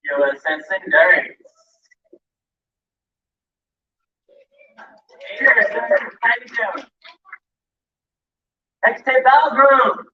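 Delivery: slow, drawn-out ship announcement; announcer roll call. Acted. Emcee announcing chapters at the parade, slow announcer call.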